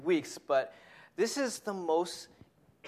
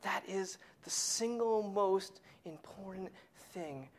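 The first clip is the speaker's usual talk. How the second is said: soft whisper, much quieter; hushed; 9 dB quieter than usual. Acted. Preacher hushing the key point to the congregation. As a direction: lean in and hush it.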